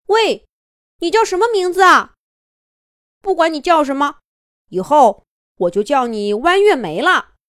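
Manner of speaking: high, loud call over the shoulder; wolf calls back to a new friend while running off, high and loud. Acted. Calling back while running away.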